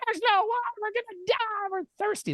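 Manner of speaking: very high-pitched whiny wail; hammed-up panicked whining, squealed high; mock crying freak-out. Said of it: "freaking out and crying".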